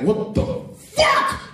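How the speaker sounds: high incredulous what the fuck, drawn out; mock outrage. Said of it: "I was so mad".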